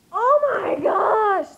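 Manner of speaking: loud, high, drawn-out shout; overwhelmed excitement. Real, not acted. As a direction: let out a stretched fangirl cry. Reliving discovery of the retweet.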